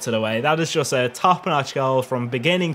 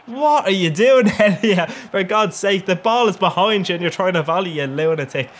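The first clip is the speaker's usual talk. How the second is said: high, raised-voice exasperation; exasperated scolding; 5 semitones higher, 4 dB louder than usual. Real, not acted. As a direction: berate your own player in disbelief. Scolds his player for a miss.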